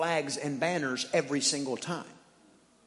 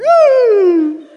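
very high, loud, slow whine; dog whine; 16 dB louder than usual. Retold or imitated. Dog whining from the laundry room, high and drawn out. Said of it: "and I hear".

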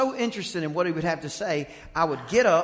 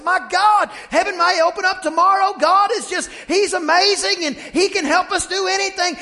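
high loud exalted exclaiming; hammed-up young believer on fire; glowing with faith; 14 semitones higher, 9 dB louder than usual. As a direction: burst out full of faith. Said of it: "I said".

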